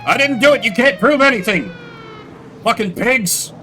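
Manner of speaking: high, loud guilty janitor protesting arrest; English-sounding Gaston. Acted. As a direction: play a caught crook yelling at cops. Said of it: "What do you mean a very English-sounding Gaston?".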